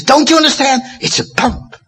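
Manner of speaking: urgent then hushed awe; excited exclamation. Retold or imitated. Harvey amazed at his own discovery, excited then hushed. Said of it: "he really gets excited".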